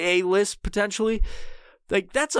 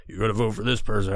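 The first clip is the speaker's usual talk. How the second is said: lower, faster mock Thanos voice; Thanos impression; 7 semitones lower, faster than usual. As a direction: drop down for a rough Thanos. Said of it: "however the fuck he talks".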